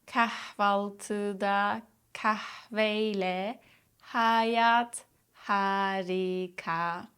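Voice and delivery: "Kahvaltıda kahveyle hayat harika" very slowly, each H lightly exhaled; slow demo. Shown as a demonstration. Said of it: "Practice lightly exhaling on the H sounds".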